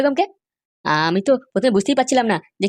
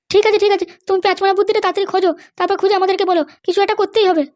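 much higher-pitched woman's voice; a high female character voice; mother's voice; 9 semitones higher than usual. Acted. Mother urges Kotkoti.

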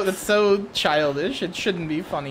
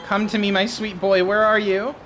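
higher, louder sing-out to a lost darling; playful siren call; 5 semitones higher, 5 dB louder than usual. Pretend. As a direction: call out sweetly for your missing boy, playing it up. Playfully calling a missing game character.

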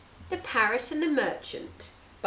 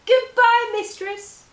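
high, loud parting call; bright farewell; 12 semitones higher, 10 dB louder, slower than usual. Acted. Parrot calls goodbye to its mistress, high and joyful. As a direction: sing out goodbye as you fly off.

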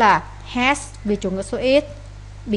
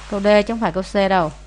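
normal talking voice

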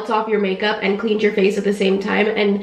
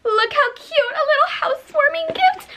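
very high cooing over gift; excited squeal. Real, not acted. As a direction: coo like it's adorable. Showing sister's gift.

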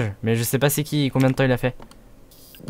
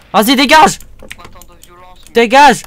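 higher, louder dismissal; mock angry shove-off; 8 semitones higher, 5 dB louder than usual. Streamer chasing a character off, higher and louder. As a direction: bark him away. Shouting someone away.

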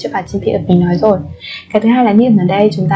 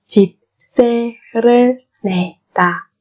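slow 집세를 내다, each syllable separate; slower than usual. Shown as a demonstration. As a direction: space out every syllable of 집세를 내다. (đọc 집세를 내다 chậm từng âm tiết)

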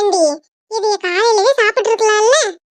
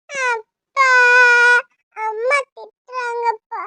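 put-on small, hurt child's tattle; tearful complaint; slower than usual. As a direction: whimper it, dragging out the words.

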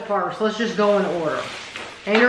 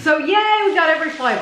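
excited; 8 semitones higher than usual. Real, not acted. Celebrating having every flavor.

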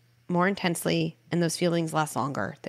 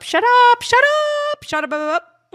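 much higher, louder playful shouting; yelling it out; 10 dB louder than usual. Shown as a demonstration. Parent shouting the bad words out with her son. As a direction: blast the words out to get them out of your body. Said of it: "And I'd say this in the room".

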